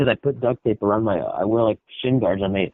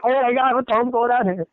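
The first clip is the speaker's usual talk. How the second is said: much higher, panicked startle; alarmed wake-up; 11 semitones higher than usual. Retold or imitated. Describing a night cramp to a friend, high panicked startle. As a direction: yelp like a cramp just woke you. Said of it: "and it's like".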